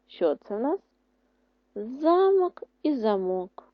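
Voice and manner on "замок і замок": slow ЗАмок, then заМОК; first замок stressed high on ЗА, second on МОК; contrasting word stress. Shown as a demonstration. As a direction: stretch each замок, moving stress from first to last syllable. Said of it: "Що не зміниш ти наголос слова".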